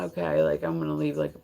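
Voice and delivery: low, quiet mock stalker; understated, low-key admirer impression; not-crazy stalker. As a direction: lightly voice a mild-mannered stalker. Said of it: "He was just, like".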